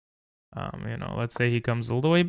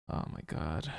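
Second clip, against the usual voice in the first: despairing mutter; 8 semitones lower, 10 dB quieter than usual. Real, not acted. Down a rook, giving up.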